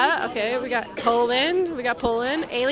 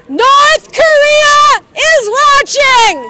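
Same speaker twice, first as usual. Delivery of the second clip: shouting; 13 semitones higher, 11 dB louder, much slower than usual. Real, not acted. Calling out to distant crowd.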